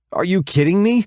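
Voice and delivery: high, loud incredulous outburst; can't believe this house; exasperated disbelief. Acted. Sees kitchen full of windows.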